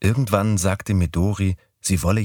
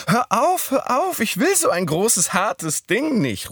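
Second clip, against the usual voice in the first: higher, pleading mock protest; a hammed-up plea to stop; Midori playing mock protest. A narrator's reading. Narrator voicing Midori acting out her fantasy protest, higher.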